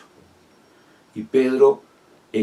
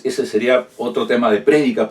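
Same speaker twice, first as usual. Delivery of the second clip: ordinary delivery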